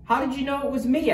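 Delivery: high, quick question; surprised question. A narrator's reading. Turkey caught in disguise by the elf, high quick question. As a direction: ask it fast and high-pitched. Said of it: "asked Turkey".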